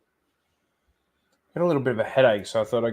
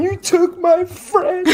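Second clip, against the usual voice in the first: mock tree rage; 6 dB louder, slower than usual.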